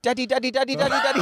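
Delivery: put-on high pestering toddler voice; pestering child. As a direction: whine daddy over and over, high. Toddler ghost calling dad.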